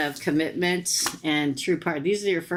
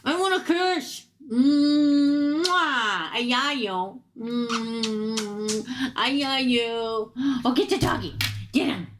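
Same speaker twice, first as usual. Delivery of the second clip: doting pet talk; 8 semitones higher, slower than usual. Real, not acted. Owner cooing at her dog for a kiss, high baby talk.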